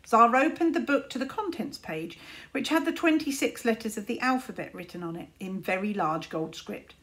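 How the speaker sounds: ordinary delivery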